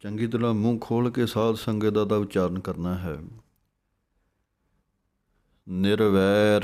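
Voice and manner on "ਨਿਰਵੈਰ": ਨਿਰਵੈਰ said as one word, firm and louder; correct pronunciation. Shown as a demonstration. Teacher models ਨਿਰਵੈਰ to the sangat, firmly. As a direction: say ਨਿਰਵੈਰ whole, with weight. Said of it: "ਨਿਰਵੈਰ ਪਾਠ ਇਕੱਠਾ ਹੈ".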